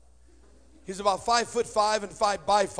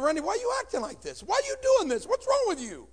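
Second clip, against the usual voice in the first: much higher, wailing distressed mom; crying mom; 12 semitones higher than usual. Retold or imitated. Crying mother confronts her son on the stairs, distraught. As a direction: plead through tears.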